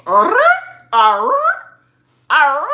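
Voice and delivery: loud frustrated arr; frustrated growls. Real, not acted. Reacting to Joseph's claim, growling. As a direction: growl out her annoyance.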